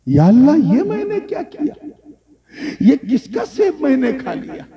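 high, alarmed cry to God; sudden dismay at his own deed; shocked gasp. Retold or imitated. Young man realizing he ate another's apple, aghast.

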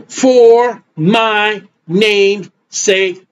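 very slow, word-by-word delivery; deliberate quotation. Real, not acted. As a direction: space out every word of the scripture, weightily. Slow emphatic scripture quotation.

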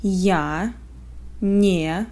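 ordinary talk

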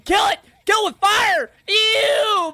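high, loud grossed-out shout; disgusted yell. Real, not acted. Grossed out by creature.

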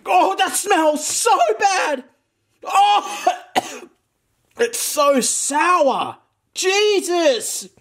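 high, slow disgusted groans. Real, not acted. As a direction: recoil from a sour stink. Reacting to sour smell.

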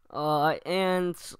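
normal talking voice

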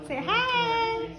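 high, loud sing-out; eager prompting. Real, not acted. Parent gets baby to greet the camera, high eager call.

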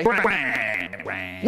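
mock snarl falling low. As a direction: snarl it out, sinking in pitch. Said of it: "and Sheddle snarled".

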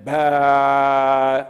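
put-on loud toddler cry sound; mock baby wail. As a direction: wail like a child about to bawl. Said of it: "Krishna was about to cry".

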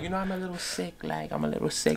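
soft put-on girly whine; lightly voiced sick girl. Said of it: "she could just be like".

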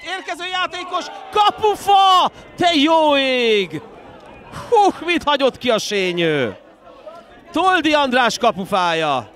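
screaming play-by-play at a near goal; excited hungarian sports commentary. Real, not acted. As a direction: yell it as the ball hits the post. Goal chance in live commentary.

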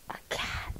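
hushed, breath-only whisper. Real, not acted. Adult playing along with child's whispering, in a whisper. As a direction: whisper it back very softly.

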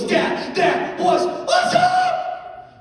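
very high, screechy shout; loud mock kid frenzy, voice climbing; wildly hyped kid. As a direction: squeal it like an overexcited child. Hyped daughter runs up after ride.

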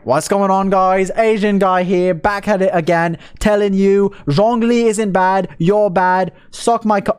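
loud British streamer intro impression; drawn-out mock British hello, turning taunting; Atsu. Streamer demonstrates his friend's intro, loud British impression. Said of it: "because it's his intro".

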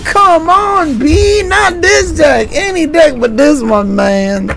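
high, slow mock whine; whiny complaint. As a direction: whine like you're begging, high.

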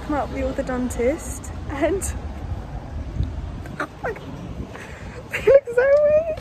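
muffled laugh behind mask; laughing through a face mask. Real, not acted. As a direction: mask partly covering mouth. Seen 0: Laughs while lowering surgical mask to chin, then lifting it again.

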